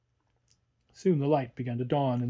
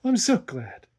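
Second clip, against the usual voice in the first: higher, louder joyful cry; glad cry; 8 semitones higher, 5 dB louder than usual. A narrator's reading. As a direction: governess delighted the pain has come. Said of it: "cried the governess".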